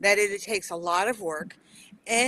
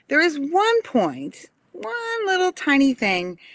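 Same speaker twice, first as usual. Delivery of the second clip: playful teasing emphasis; 8 semitones higher, 5 dB louder than usual. Real, not acted. Teasing build-up before correcting bio.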